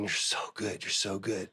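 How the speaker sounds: breathless whisper, overwhelmed with gratitude; barely voiced, as if crying at the altar; weeping whispered prayer.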